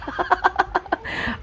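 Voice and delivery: high breathy laugh; tawa. Real, not acted. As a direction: laugh at your own joke. Laughing at own joke. (tertawa geli)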